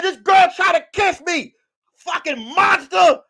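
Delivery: high, slow indignant holler; mock outrage. Telling all the kids about the kiss, mock outraged.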